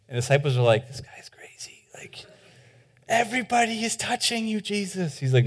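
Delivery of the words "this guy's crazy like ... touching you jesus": hushed, scoffing whisper; whispered aside. Acted. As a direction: mutter it behind his back. Disciples doubting Jesus in a crowd.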